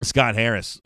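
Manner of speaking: ordinary talk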